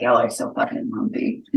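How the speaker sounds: low grumpy character voice. Acted. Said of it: "and be like".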